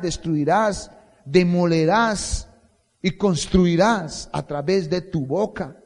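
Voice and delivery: high, loud, slow preaching of God's commands; full-force preaching. Acted. Pastor voices God's charge to Jeremiah, thundering. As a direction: proclaim each verb like a decree.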